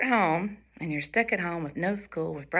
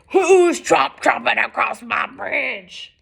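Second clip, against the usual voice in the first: slow, loud, raised roar; roaring troll; 4 semitones higher, 6 dB louder, slower than usual. Acted. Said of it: "A roar rose out from below".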